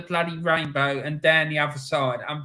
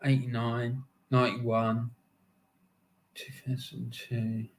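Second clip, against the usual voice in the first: counting to himself; 7 semitones lower, 8 dB quieter, slower than usual. Real, not acted. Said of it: "let me count how many I've seen".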